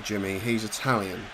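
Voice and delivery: ordinary delivery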